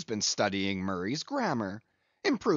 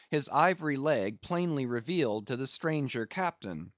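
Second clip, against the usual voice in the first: ordinary delivery, nothing marked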